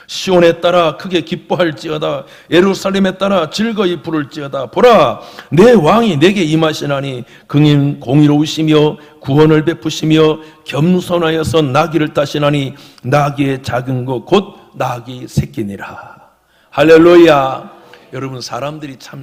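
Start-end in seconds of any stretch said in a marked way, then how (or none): 0.1-16.3 loud, ringing Bible reading; scripture proclamation. Real, not acted. Pastor reads Zechariah's prophecy to the congregation, loud proclamation. 16.7-17.8 loud drawn-out call, falling at the end; preacher's acclamation. Real, not acted. Pastor calls the congregation after scripture, loud slow acclamation.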